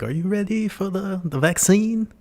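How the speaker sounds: put-on a sinister villain's question in an overdone Swiss German accent; Klaus Schwab accent. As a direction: ask it in a creepy, hammed-up Swiss German accent. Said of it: "I hope my accent was enough".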